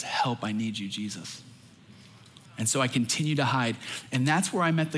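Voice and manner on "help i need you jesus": hushed plea. Retold or imitated.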